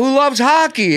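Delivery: high, loud emcee question; onstage crowd work. Retold or imitated. Comic redoing his opener to a Toronto crowd. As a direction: call it out to the theater.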